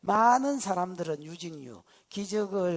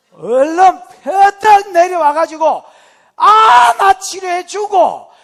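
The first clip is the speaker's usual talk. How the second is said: slow, loud Gyeongsang plea to hurry; Gyeongsang dialect; 12 semitones higher, 19 dB louder, slower than usual. Acted. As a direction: urge him down in Gyeongsang dialect. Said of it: "경상도 말로".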